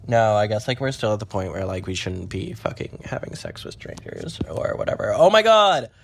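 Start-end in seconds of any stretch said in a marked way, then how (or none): 5.1-5.9 high, louder oh my god; sudden shocked exclamation; startled. Real, not acted. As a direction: blurt it out in shock. Sees someone running outside.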